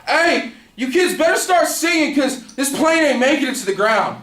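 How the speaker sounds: high-pitched shout to a whole plane; imitating a drunk passenger yelling; yelling announcement. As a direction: holler it out like a rowdy drunk. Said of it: "he yells to the plane".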